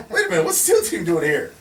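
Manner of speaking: high and loud, indignant; indignant outburst. Retold or imitated.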